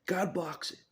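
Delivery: loud, high exclaiming. Real, not acted. Telling viewers God blocked his death, loud exclaim. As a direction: burst out with disbelief.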